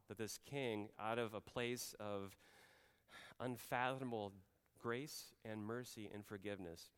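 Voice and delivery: usual speaking voice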